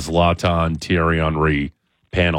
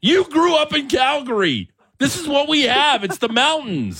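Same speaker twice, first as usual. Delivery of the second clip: mock exasperation. Mock outraged retort to pundit.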